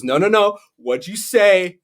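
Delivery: high, slow mock interrogation; teasing dad. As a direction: drag the confession out. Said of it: "He goes".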